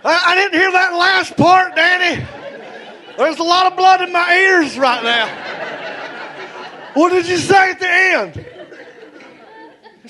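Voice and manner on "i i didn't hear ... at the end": stunned disbelief. Retold or imitated. Kid hearing his friend faked it, high shocked shout. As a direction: demand he repeat it, outraged.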